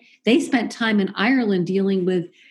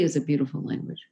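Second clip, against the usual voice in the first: whisper; 6 semitones lower, 6 dB quieter, faster than usual. Real, not acted. Aside after Russian imitation.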